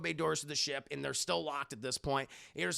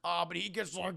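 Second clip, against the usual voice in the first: usual speaking voice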